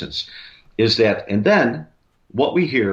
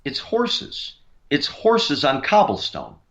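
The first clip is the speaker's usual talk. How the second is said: high-pitched imitation of an awed investigator; Zach; 7 semitones higher than usual. TV host asking crew about horse sounds, high imitated voice. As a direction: act out the TV host's discovery, pitched well up. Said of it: "Zach's standing there, and he says".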